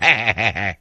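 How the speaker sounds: short real laugh at own joke; amused laugh. Real, not acted. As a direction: laugh at your own bit.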